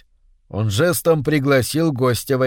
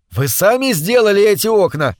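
shouting; 6 semitones higher, 4 dB louder than usual. A narrator's reading. Shouting over waterfall noise.

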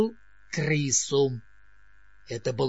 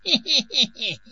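squeaky high rat snicker; twitchy squeaking rat voice; 8 semitones higher, 4 dB louder than usual. A narrator's reading. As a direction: squeal a little rat laugh. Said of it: "Крыса снова пропищала".

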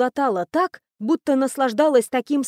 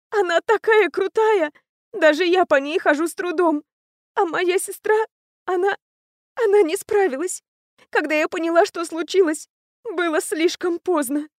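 high tearful voice, halting at the worst part; through tears; 7 semitones higher than usual. A narrator's reading. Captive telling the prince how her sister died, through tears. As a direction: choke out the story in a high, crying voice. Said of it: "говорила она сквозь слёзы".

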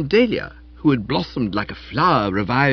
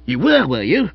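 louder, higher scornful retort; sarcastic; 5 semitones higher, 6 dB louder than usual. Acted. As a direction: throw his words back at him.

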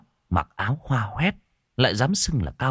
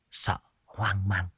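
fearful whisper; 6 dB quieter, slower than usual. A narrator's reading. Narrator whispers mother's fear.

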